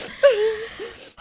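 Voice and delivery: high, sniffly crying sigh; snotty crying. Real, not acted. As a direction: wet sob of a sigh after tears. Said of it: "my nose is running because I was crying".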